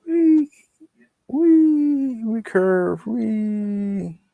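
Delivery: playful high voice. Pretend. Leads into pig squeal.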